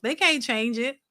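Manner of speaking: ordinary talk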